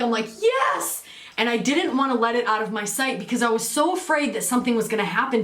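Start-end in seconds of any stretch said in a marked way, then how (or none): 0.4-1.0 high exclamation, retelling a win; retold cheer, pitched way up; triumphant yes. Telling how she grabbed the pick, triumphant.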